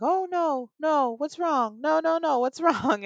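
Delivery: imitating a high-pitched, fretting little old lady; concerned old neighbor. Old lady finding her neighbor crying, imitated high-voiced concern. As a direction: fuss in a high, alarmed voice. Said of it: "she saw me crying, and she's like".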